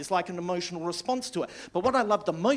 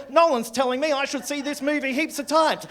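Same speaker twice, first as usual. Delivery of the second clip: ordinary talk, nothing marked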